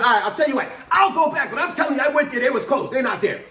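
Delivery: put-on old driver complaining to dispatch; grudging irritated old man. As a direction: agree while making it clear you're annoyed. Jerry grudgingly agrees, still irritated.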